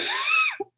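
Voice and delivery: short high giggle; brief amused giggle; real laugh. Real, not acted. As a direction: break into a soft, high laugh. Laughs while admitting she was upset.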